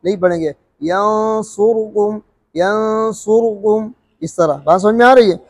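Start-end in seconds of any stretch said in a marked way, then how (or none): 0.8-2.2 यंसुरकुम recited very slowly, the vowel on रा only two-thirds voiced; ikhtilas demo. Shown as a demonstration. Teacher demonstrates ikhtilas on यंसुरकुम, slow recitation. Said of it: "इख्तिलास पढ़ने का तरीका ये है". 2.6-3.9 यंसुरकुम recited very slowly, the vowel on रा only two-thirds voiced; ikhtilas demo. Shown as a demonstration. Teacher demonstrates ikhtilas on यंसुरकुम, slow recitation. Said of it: "इख्तिलास पढ़ने का तरीका ये है".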